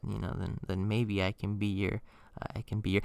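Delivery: shyly edge toward a suggestive offer; coy mumbled hint. Pretend. Coy trailing-off joke before outburst.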